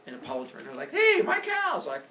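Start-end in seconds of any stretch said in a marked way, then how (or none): 0.9-1.9 high, loud cry; cartoonish happy shout; delighted discovery. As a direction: call out way up high. Apollo spots his lost cows.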